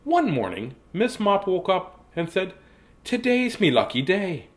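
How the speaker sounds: storybook narration turning into drawn-out character voice; reading to children. Acted. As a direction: act out the happy baker for children. Reads the story, voicing Miss Mop.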